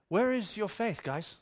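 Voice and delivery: gentle chiding that drops away; mild, unbothered question. Acted. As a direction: ask it casually, no rebuke at all. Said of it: "That's not how this plays out".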